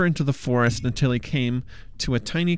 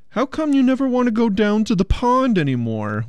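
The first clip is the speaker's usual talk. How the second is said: high, whining prince voice; whiny prince; 9 semitones higher than usual. A narrator's reading. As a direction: whine it like a sulky prince. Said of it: "whined the prince".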